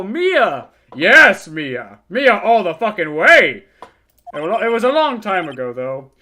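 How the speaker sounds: a hint of a rattled old man ranting; crude old lawyer. Old lawyer ranting about the girl, mildly worked up. Lawyer boasts, reacts loudly.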